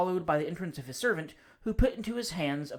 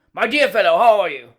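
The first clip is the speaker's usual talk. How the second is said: hearty greeting, much louder; top of his voice; 4 semitones higher, 16 dB louder than usual. Acted. Cousin bursts in on the bachelor, booming at full voice. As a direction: boom the greeting across the room. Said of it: "He always spoke at the top of his voice".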